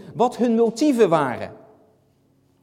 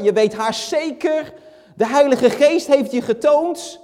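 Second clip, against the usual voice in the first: higher, louder, insistent conviction; utterly convinced, pitched up; mock certainty; 8 semitones higher, 5 dB louder than usual. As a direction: build to an emphatic, over-sure claim. Voices someone's overconfident assumption.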